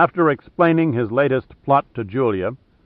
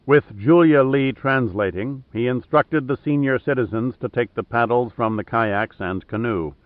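usual speaking voice

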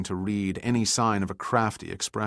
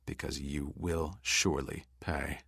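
steely threat; 4 semitones lower, 8 dB quieter than usual. A narrator's reading. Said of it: "in a voice that could have cut steel".

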